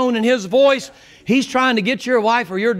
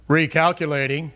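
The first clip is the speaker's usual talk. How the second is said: GPS voice; 6 semitones lower than usual. Retold or imitated. Preacher mimics the sat-nav telling him it is recalculating. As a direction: play the sat-nav lady announcing a reroute.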